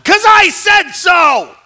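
exasperated parent screaming, high and drawn out; parental scream. Acted. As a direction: scream it at the kids, fed up, each word stretched. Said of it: "You scream at 'em".

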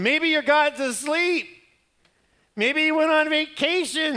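high, loud, slow mocking jeer; mocking taunt. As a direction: taunt them, drawing out the ridicule. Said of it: "he's sitting there ridiculing him".

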